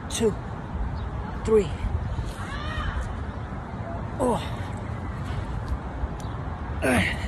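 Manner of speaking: breathless final reps; doing push ups. Real, not acted. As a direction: squeeze out each count, exhausted.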